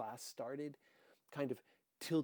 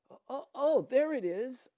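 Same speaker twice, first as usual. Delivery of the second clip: high, loud gushing over nothing; fake excitement; 12 semitones higher, 14 dB louder than usual. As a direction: pretend to see it, overdo the enthusiasm. Faking excitement to please cop.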